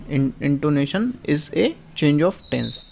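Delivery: spelled out very slowly, word by word; slow dictation. Shown as a demonstration. Teacher reading the answer to the class, very slow dictation. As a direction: read it out slowly so students can copy. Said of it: "teacher reads answer for dictation".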